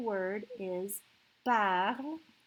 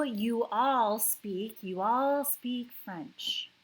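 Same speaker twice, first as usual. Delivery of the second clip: all stretched and slow; stress on all; 5 dB louder, slower than usual. Shown as a demonstration. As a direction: lean slowly on all. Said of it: "teacher stressing all".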